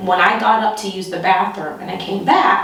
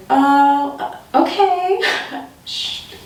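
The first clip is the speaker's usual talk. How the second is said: high, uneasy agreeing; high reluctant okays; nervous agreement; 5 semitones higher, slower than usual. Retold or imitated. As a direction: go along high and uncertain.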